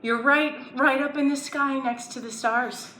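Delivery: ordinary talk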